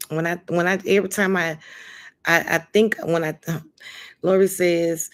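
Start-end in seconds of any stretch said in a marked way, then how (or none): none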